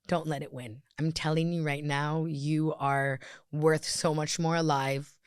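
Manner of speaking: low, subdued, heartfelt plea; fighting back tears. Real, not acted. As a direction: plead softly while trying not to cry. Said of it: "I'm gonna try not to tear up".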